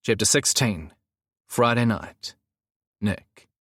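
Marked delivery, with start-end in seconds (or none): none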